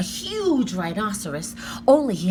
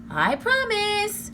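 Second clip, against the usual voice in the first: high slow sweet promise; drawn-out sing-song; 12 semitones higher, much slower than usual. Acted.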